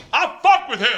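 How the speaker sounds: high, put-on voice of an impressed guy in the crowd; impressed dude.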